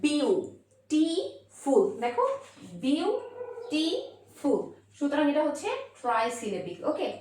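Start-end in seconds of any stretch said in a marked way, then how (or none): 0.0-1.8 beautiful very slowly in three clear beats: বিউ, টি, ফুল; split in three syllables. Shown as a demonstration. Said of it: "তিনবার উচ্চারণ হয়েছে". 2.8-4.6 beautiful very slowly in three clear beats: বিউ, টি, ফুল; split in three syllables. Shown as a demonstration. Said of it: "তিনবার উচ্চারণ হয়েছে".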